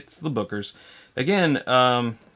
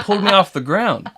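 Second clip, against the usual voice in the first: higher, louder, breaking into laughter; laughing impression; 10 semitones higher, 8 dB louder than usual. Host cracks up retelling the hammer scene, laughing in character. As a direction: crack up while keeping the character going.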